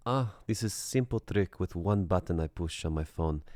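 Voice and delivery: lightly put-on Eastern European accent; a hint of an Eastern European caller, smug. The caller explains her blocked number in an Eastern European accent. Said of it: "that Eastern European tone".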